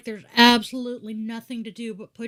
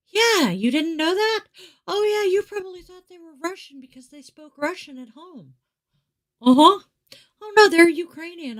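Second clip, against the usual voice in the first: higher, bright-voiced quote of her child, lightly voiced; imitating her kid; 9 semitones higher than usual. Mother retelling what her middle child said, higher imitation. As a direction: play the kid, pitched up and casual. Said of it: "my kiddo made reference to".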